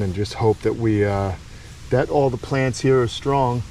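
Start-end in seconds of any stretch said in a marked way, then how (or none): none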